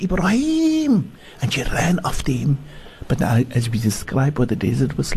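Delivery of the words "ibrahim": a high, drawn-out call across the valley; shouting his name. Acted. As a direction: call after him as he leaves. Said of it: "she shouted".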